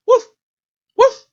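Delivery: loud, very high yappy woof; sharp yip barks; dog barking. Acted. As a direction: bark like a small excited dog.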